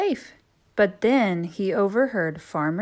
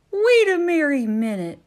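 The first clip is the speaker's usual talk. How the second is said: christmas elf cry; 8 semitones higher, 8 dB louder than usual. Acted. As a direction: stop the reindeer firmly. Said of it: "cried an elf".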